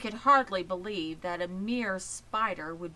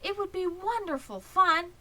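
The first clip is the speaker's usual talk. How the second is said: higher and louder, coaxing; pleading young pig; 12 semitones higher, 5 dB louder than usual. Acted. Wilbur pleading with Charlotte to come along, higher and coaxing. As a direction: plead with her like an eager little pig. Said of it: "pleaded Wilbur".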